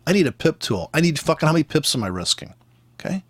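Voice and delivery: ordinary talk, nothing marked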